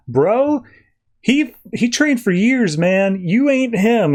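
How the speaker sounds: high-pitched mock disbelief; retold protest, pitched well up; incredulous retort. Retold Lois scoffing at Clark.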